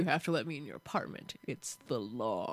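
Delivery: playing a sexy firefighter demanding entry. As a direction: purr it like a hunky fireman. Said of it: "Like a sexy motherfucker".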